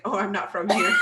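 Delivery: loud, laughing through the words; laughing reply. Real, not acted. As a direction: burst out laughing as you answer. Answering doorman while laughing.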